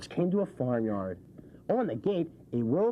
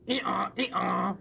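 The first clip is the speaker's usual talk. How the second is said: donkey bray; 4 semitones higher than usual. Pretend. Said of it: "The donkey sang".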